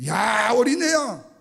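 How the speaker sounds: long, drawn-out high shout; loud, slow taunting holler; bellowing Goliath. Acted. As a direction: yell mockingly at a child opponent. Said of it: "고래고래 소리를 지르는 거예요".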